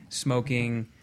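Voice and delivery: ordinary talk, nothing marked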